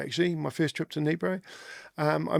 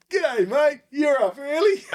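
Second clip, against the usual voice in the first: bright, much higher Aussie hail; cheery shouted g'day; 10 semitones higher, 3 dB louder, slower than usual. Retold or imitated. Greeting the soldier at his car window, a cheery higher shout. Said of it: "I said".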